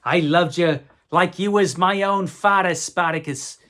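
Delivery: hammed-up movie-hero declaration of love; Tony Curtis impression. Reviewer quoting a favourite line from Spartacus, hammed-up actor impression. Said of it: "Tony Curtis was awesome".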